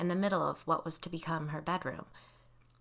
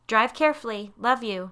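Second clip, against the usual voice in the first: louder, higher call from upstairs; raised, projected goodbye; 5 semitones higher, 7 dB louder than usual. A narrator's reading. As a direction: holler a fond send-off downstairs. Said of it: "Laura called down from upstairs".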